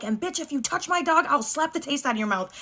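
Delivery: hammed-up warning, pitched well up; mock-furious threat. Warning roommate's girlfriend off her dog, mock-furious.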